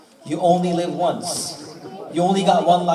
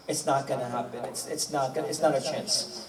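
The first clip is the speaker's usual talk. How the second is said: low, much softer voice; quiet, halting admission of defeat; retold resignation; 5 semitones lower, 9 dB quieter than usual. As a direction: drop right down as if giving up. King gives up in story.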